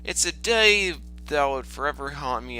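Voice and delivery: normal talking voice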